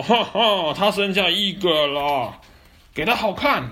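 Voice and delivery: high, loud laughing bully; cackling villain. Acted. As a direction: cackle and gloat.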